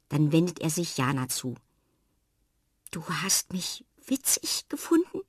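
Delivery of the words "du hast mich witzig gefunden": hushed, tearful question; shy whisper. Acted. Fred whispers his question to Jana, shy and moved. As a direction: barely dare to ask.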